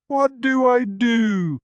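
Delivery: high, slow, teary whimper; a girl near tears asking for help; weepy. A narrator's reading. Darkness stuck in the bathroom, asking in a weepy voice. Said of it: "Darkness's weepy voice".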